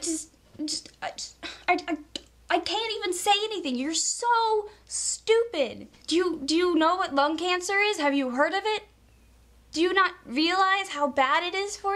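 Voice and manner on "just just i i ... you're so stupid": high, fed-up outburst; exasperated rant. Real, not acted. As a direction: lose patience with them. Ranting at smokers.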